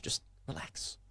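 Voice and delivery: soothing hushed whisper. Acted. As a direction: breathe the words to calm him down. Calming a raging coach on beach.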